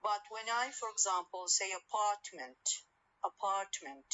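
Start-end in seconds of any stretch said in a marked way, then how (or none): 1.7-2.6 a-PART-ment, weight on PART in "apartment"; stress on second syllable. Shown as a demonstration. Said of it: "I stress the second syllable". 3.2-4.1 "apartment" as a-PART-ment, middle syllable stressed; stress on second syllable. Shown as a demonstration. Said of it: "I stress the second syllable".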